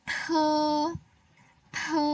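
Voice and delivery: Te with a puff of air, high; aspirated te. Shown as a demonstration. Teacher drills the aspirated t for pupils. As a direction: push air out on Te. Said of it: "Ada ya".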